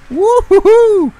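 high, loud drawn-out woohoo; excited whoop. Real, not acted. Gamer thrilled by the speeding car, excited whoop. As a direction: whoop with delight at the top of the voice.

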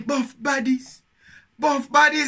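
high mock yell of the hook; imitating the rapper. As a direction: belt the rapper's two words back. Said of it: "Man say".

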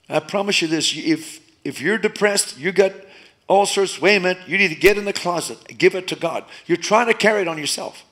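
fiery exhortation; preaching at full force. Real, not acted. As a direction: press the congregation hard, full volume.

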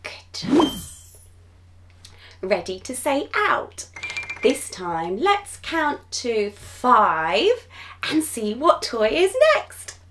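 ordinary talk

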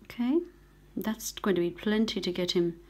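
ordinary talk, nothing marked

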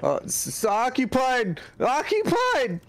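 high mock-panicked squeal; startled toilet occupant. Acting occupant of the loo.

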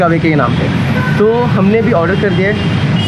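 muffled behind respirator; speaking into mask; through a face mask. Real, not acted.

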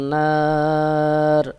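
long drawn নার ending on a vowelless r; stopping on r. Shown as a demonstration. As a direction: stretch the aa of নার and stop on the bare r. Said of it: "রতে আমি থেমে যাচ্ছি".

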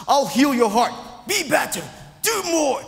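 a mock yell, pitched high and loud; mock shouting. Preacher acting out a screaming environment to the congregation, mock yell. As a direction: bark the orders like a harsh shouting healer. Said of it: "Have you ever been in a big shouting, scream".

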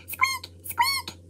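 tiny high bat squeak, very soft. Acted. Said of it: "Amara squeaked all around the house".

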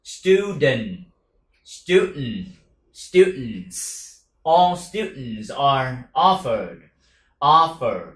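slow, drawn-out all students; slow model phrase; slow drill. Shown as a demonstration. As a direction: say all students slowly for copying. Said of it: "slow model phrase".

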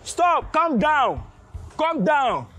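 loud, high-pitched repeated orders; shouted command. Acted. Guard ordering the trapped woman to stop, shouted command. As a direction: shout her down with short orders.

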